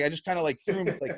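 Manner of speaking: ordinary talk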